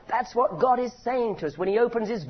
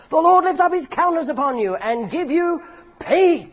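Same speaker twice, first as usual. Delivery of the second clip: higher and louder, building to "peace"; climactic blessing; 8 semitones higher, 7 dB louder than usual. Shown as a demonstration. Preacher reading the blessing's climax higher and louder. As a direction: let it crest at the top of the voice. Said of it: "the climax in the Hebrew poetry is there".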